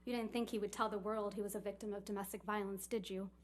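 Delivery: usual speaking voice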